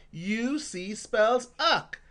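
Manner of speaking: slow, deliberate, each letter separate; teacher drilling ock slowly; slow phonics drill. Shown as a demonstration. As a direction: spell it out slowly for kids. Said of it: "And finally".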